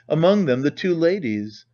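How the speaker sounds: ordinary delivery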